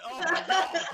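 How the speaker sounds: high, loud laughing gasp; shocked. Retold or imitated. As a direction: burst out in amused shock. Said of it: "I was like".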